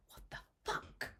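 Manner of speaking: hushed disbelieving curse; whispered shocked swear; stunned whisper. Retold or imitated. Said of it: "I was like".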